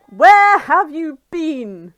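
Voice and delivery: loud, slow, high demand. Acted. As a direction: demand an answer at full volume. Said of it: "She demands".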